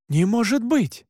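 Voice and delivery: high, shocked exclamation; disbelief. Acted. Narrator refusing to believe in vampires, shocked. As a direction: exclaim in alarmed denial, pitch up.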